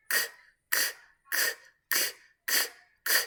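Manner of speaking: "k" as a voiceless click of air; final K sound. Shown as a demonstration. Teacher isolating the last sound of look, bare K bursts. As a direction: repeat "k" in separate bursts, no vowel. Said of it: "The last sound is this one".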